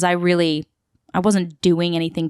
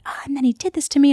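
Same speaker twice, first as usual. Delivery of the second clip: venting drama queen; 8 semitones higher, faster than usual. Retold or imitated. Voicing chaotic friend's venting about boy.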